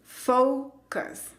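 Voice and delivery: FOCUS split slowly, high "FOU", then "cus"; diptongo y schwa. Shown as a demonstration. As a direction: stretch "fou", pause, unstressed schwa in FOCUS. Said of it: "se pronuncia con el sonido". (FOCUS por sílabas: "FOU... cus", lento)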